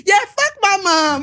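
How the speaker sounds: put-on defiant teenager jeering at her mother; bratty kid. Voicing a bratty kid on Maury.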